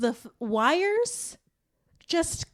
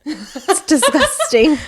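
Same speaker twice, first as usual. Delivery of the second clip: laughing; 6 semitones higher, 11 dB louder, much slower than usual. Real, not acted. Laughing at her congestion.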